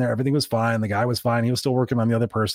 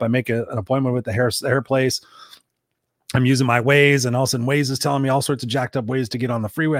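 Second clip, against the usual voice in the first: ordinary delivery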